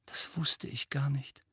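slow, high near-whisper; tearful hush. A narrator's reading. Hermine learning about Neville's parents with tears in her eyes, hushed. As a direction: breathe it out softly, shocked and sad.

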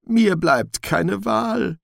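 high, slow, trembling voice. Acted. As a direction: quaver with fear on each word. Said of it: "mit zitternder Stimme".

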